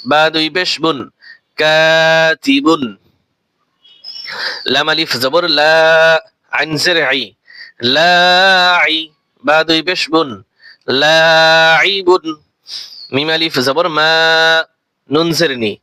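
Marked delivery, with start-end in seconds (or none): 1.6-2.8 ফাসিকুন said very slowly, the first vowel held one alif long; slow Quranic spelling blend; drawn-out blend. Shown as a demonstration. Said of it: "এক আলিফ টান দিয়ে". 8.0-12.3 very slow, long stretched vowel; slow Quranic spelling blend; drawn-out blend. Shown as a demonstration. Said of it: "এক আলিফ টান দিয়ে".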